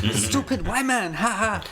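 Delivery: mock jeering voice. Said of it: "just being like".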